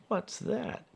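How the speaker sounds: high, startled question. A narrator's reading. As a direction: ask in sudden alarm. Attendant startled by creature.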